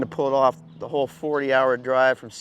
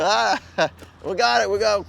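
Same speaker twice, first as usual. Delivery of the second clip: higher, laughing through the words; 6 semitones higher than usual. Retold or imitated. Laughing at the freaked-out host over the dog mess, higher. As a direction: laugh while trying to calm him down. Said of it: "I couldn't help but just start laughing".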